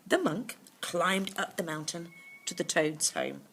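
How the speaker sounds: plain reading voice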